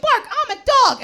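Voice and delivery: put-on a child proudly announcing he's a dog, high and slow; mock kid dog. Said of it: "it's like".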